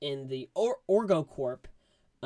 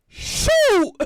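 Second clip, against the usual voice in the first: very high, loud yelp; squealed outburst; shocked exclamation; 15 dB louder than usual. Retold or imitated. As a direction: yelp in alarm. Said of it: "And I was like".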